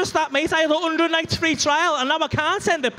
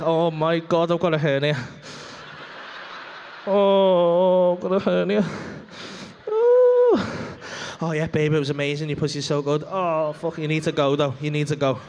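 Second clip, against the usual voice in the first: low, worn-out groaning voice; exhausted man; 8 semitones lower than usual. Retold or imitated. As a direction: gasp it out, totally spent. Man's exhausted inner voice after sex.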